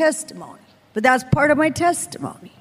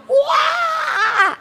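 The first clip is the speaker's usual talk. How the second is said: very high shouted wail; imitated wail; 14 semitones higher than usual. Preacher reenacting her screaming on the floor, high wail. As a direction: let out a wild high wail. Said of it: "I'm screaming like".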